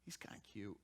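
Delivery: hushed, breathy aside; princess's soft whisper. Acted. Princess softening over the baby, whispered. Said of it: "She goes".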